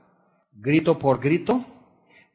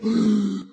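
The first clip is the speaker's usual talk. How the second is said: mock gasp; 6 semitones higher than usual. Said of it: "Ya dije lo que no se tenía que decir".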